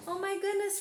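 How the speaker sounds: ordinary delivery, nothing marked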